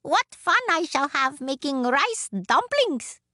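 high, gleeful little woman; piping delight. A narrator's reading. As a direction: exclaim happily in a high voice. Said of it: "she laughed".

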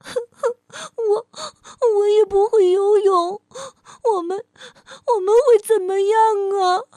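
put-on high, loud crying baby dinosaur. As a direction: sob it out in a tiny high voice. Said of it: "棘龙宝宝哭着说".

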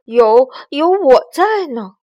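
slow, loud stammered promise; nervous bravado. Acted. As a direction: act brave with a stutter.

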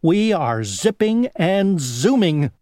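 high, zippy show-off; bright buzzing brag; dragonfly voice. Acted. First dragonfly boasts.

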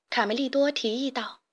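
plain reading voice

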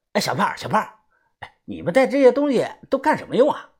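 high-pitched excited lad calling out; eager curiosity. A narrator's reading. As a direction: call to her, all curious. Eager lad runs up calling her. (兴奋好奇地高声问)

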